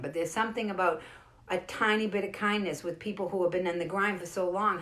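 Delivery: usual speaking voice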